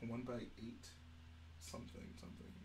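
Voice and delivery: very soft, trailing into near-whisper; barely audible absent-minded muttering; muttering to self. Real, not acted. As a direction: mumble it under your breath while searching. Talking to self while searching parts.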